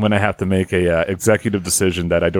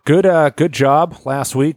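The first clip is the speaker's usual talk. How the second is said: voice going up; 6 semitones higher, slower than usual. Real, not acted. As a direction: pitch creeping up high. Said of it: "my voice is going up".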